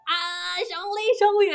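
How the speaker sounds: high, rising excited cheer; delighted cheer. Retold or imitated. Gamer cheering her new character, delighted cheer. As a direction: call the name with glee.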